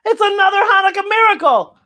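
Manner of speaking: high, loud delighted cry; mother cries out. Acted. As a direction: mom thrilled her son came out. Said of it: "cries Mama".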